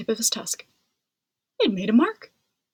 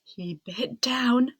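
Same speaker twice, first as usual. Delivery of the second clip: ordinary delivery, nothing marked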